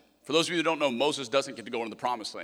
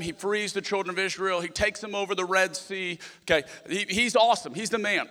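ordinary talk

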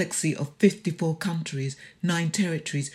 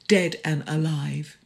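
usual speaking voice

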